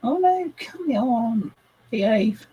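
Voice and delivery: high, pleading plea to a stubborn machine; exasperated pleading. Real, not acted. Builder begging her slow laptop to behave, high exasperated plea. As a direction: beg the laptop to behave, half laughing.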